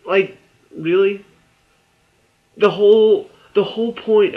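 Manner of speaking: can't believe the writers did that; incredulous. Real, not acted. Vlogger reacting to a plot twist, incredulous.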